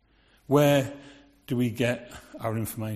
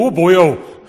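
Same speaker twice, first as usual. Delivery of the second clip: loud, hammed-up Welsh exclamation; mock Welsh accent; 5 semitones higher, 14 dB louder, slower than usual. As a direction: boom it out in a put-on Welsh accent. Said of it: "she was going to be Welsh".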